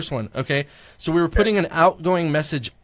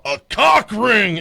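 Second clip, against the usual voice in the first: slow, louder mock yell; 5 semitones higher, 6 dB louder, much slower than usual. Shocked man on the phone, mock yell. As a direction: belt it out, drawn out. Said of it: "he yells it out really loud".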